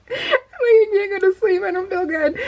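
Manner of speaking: laugh-crying through the words, barely getting them out; laughing helplessly. Real, not acted. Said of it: "we were laughing this whole time".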